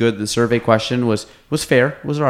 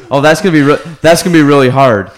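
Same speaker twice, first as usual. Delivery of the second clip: quoting Jim again; 4 semitones higher, 7 dB louder than usual. Retold or imitated. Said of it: "I guess from the top".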